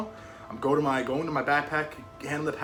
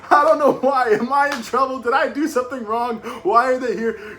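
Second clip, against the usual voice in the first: very high, loud, astonished panic; hammed-up shouting into the phone; acted frantic outburst; 14 dB louder than usual. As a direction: blurt it all out frantically at top volume.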